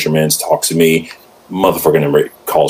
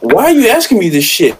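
high-pitched comic protest; mock exasperation. Husband retelling his protest at partner's question, mock exasperated. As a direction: complain in a put-upon, much higher voice.

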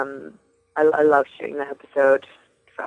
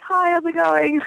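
high, light friendly hello; chirpy off-duty zombie; casual zombie greeting; 13 semitones higher than usual. Retold or imitated. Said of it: "Like this".

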